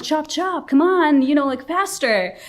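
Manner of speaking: high, loud hurry-up urging; impatient hurrying. Retold or imitated. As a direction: rush him along, exasperated. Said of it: "I'm like".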